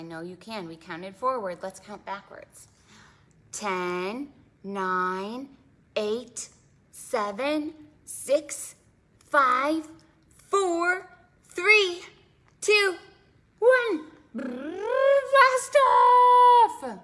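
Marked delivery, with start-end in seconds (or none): 3.5-14.1 starts plain, climbs higher and louder each number; building countdown. Acted. Countdown to blast-off with kids. 14.4-17.0 much higher and louder rocket cry; blast-off shout. Acted. Rocket launch in story.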